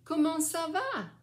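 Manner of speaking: high, loud, lively question; bright modelled question. Shown as a demonstration. Grandmother asks the child how he is, high and lively.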